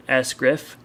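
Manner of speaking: ordinary delivery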